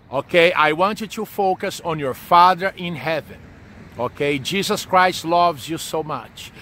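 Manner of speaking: normal talking voice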